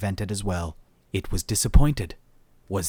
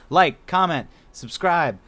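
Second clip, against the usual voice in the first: higher, sing-song self-promo patter; mock sales pitch; 7 semitones higher than usual. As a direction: rattle off the plugs like a cheeky huckster. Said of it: "Shill, shill, shill".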